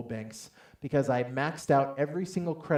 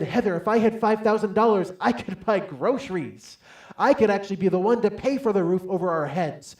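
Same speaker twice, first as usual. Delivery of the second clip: loud, high-pitched arguing; worked-up husband protesting; exasperated protest; 7 semitones higher, 7 dB louder than usual. Retold or imitated. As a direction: plead your case at full volume. Retold protest to wife.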